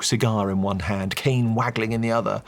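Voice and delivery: ordinary delivery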